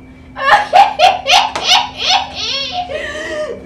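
loud high belly laugh; big elephant laugh. Acted. Elephant laughing at the funniest thing, loud laugh. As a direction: let the laugh burst out. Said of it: "he began to giggle".

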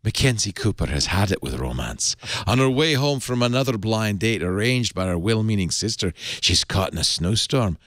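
put-on playful Scottish accent, dramatic book-blurb reading. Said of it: "doing this in a Scottish accent".

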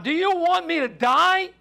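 high, incredulous question; alarmed protest. Acted. Hanging man challenges the Lord's order, alarmed protest. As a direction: protest as if your life depends on it.

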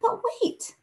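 urgent high-pitched call; exclaiming. Acted. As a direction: stop him before he leaves. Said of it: "exclaimed Squirrel".

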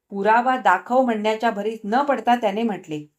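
usual speaking voice

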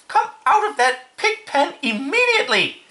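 very high, emphatic scolding; crying out sternly. A narrator's reading. Mother to her son in the pen, high scolding cry. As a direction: shriek a furious motherly order. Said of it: "cried Missus Arable".

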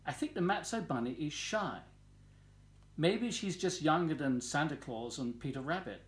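ordinary delivery, nothing marked

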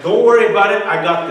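loud, cocky mock confidence; forceful assurance. Husband assures wife he'll punish son, forceful. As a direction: retell it loudly, brushing the worry aside.

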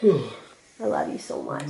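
low, slow, soft affection; sigh, then say it softly and slowly; tender. Real, not acted. Mother to her loved one in an aside, tender.

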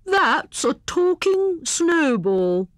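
high, slow, drawn-out hare voice; explaining Hare. A narrator's reading. Hare explains the snowball to his friends, explaining. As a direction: explain a marvel grandly. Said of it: "explained Hare".

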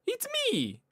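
very high falsetto It's me; high voice test. Shown as a demonstration. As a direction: squeak it out way up high, testing the mic. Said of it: "高い声か？".

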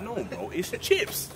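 high, drawn-out insistence; mock exasperated correction. Acted Brit correcting an American friend, high slow insistence. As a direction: push back slowly on the word.

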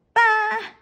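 grumbled bah. A narrator's reading. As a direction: spit it out in disappointment. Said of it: "grumbles Shark".

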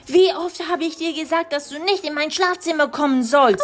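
höhere, genervte Mama-Stimme; Mama schimpft. Acted. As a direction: als aufgebrachte Mutter höher sprechen. (Mutter findet Tochter im Schlafzimmer, höher und genervt)

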